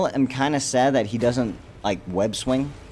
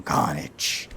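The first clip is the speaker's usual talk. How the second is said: growled whisper; 7 semitones lower, 4 dB quieter than usual. Pretend. Dramatic name of the villain.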